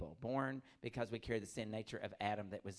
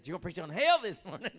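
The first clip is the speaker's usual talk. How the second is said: teasing friend; 8 semitones higher, 14 dB louder than usual. Retold or imitated. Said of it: "He said".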